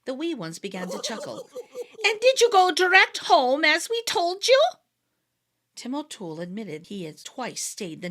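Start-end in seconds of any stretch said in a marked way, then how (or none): 2.0-4.6 higher, louder knowing question; knowing fairy. A narrator's reading. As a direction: ask it already knowing the answer. Fairy leader questions Tim.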